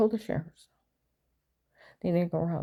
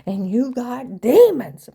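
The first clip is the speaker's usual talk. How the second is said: rising into an outraged holy shout; mom yelling about demons; 3 semitones higher, 10 dB louder than usual. Retold or imitated. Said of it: "would have looked at him and said".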